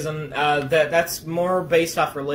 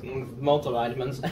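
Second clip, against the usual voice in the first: talking through a mouthful of vitamins; mouth full; 3 semitones lower, 5 dB quieter than usual. Real, not acted. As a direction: speak around a mouthful, words a bit blurred. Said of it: "I'm talking with my mouth full".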